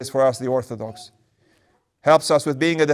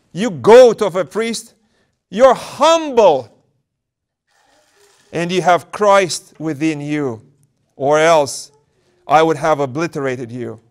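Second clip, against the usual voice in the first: indignant demon; 5 dB louder, slower than usual. Acted. Enraged demon cursing the humble elder. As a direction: spit it at the priest in rage. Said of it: "With renewed indignation".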